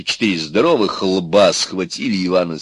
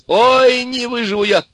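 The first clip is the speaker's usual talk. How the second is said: very high, helpless howl; yelling with mirth; 5 dB louder than usual. A narrator's reading. Ivan can't survive the joke, howling. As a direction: cry out as if laughter is killing you. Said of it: "орал Иван".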